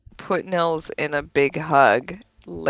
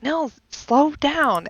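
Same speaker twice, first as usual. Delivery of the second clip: slow warning; 9 semitones higher, slower than usual. Retold or imitated. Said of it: "and I'm like".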